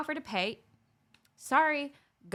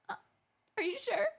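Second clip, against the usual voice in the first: fake polite offer; 11 semitones higher, 5 dB quieter than usual. Shown as a demonstration. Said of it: "the fake reach".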